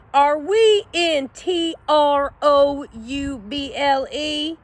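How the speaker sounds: trouble spelled slowly, letter by letter; slow ominous spelling. A narrator's reading. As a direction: drag out every letter with dread. Hank spells the word out.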